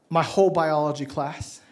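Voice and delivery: ordinary talk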